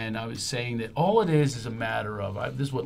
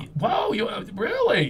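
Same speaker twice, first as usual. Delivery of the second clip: surprised; 8 semitones higher than usual. Real, not acted. Shocked at his school.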